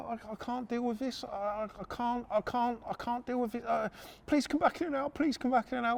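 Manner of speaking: mock the bloke falling apart; panicky. Shaking bloke begging bailiffs to come back later, panicky. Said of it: "he starts, like, shaking".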